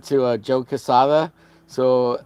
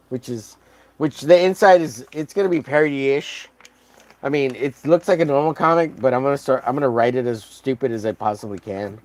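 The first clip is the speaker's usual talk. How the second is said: ordinary talk, nothing marked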